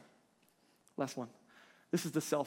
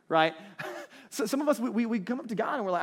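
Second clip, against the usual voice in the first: usual speaking voice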